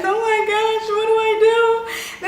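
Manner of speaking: high frantic cry; panicked. Retold or imitated. Panicking, told she's breathing hard.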